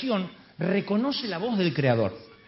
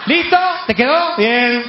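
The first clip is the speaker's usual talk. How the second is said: high, loud, curt check-back; fed-up shouting; 6 semitones higher, 9 dB louder, slower than usual. Acted. Fisherman dismissing the man on the shore, fed-up shout. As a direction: shout it to shut someone up. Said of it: "Juan se cansa".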